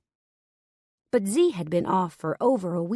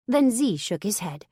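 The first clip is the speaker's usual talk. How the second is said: plain reading voice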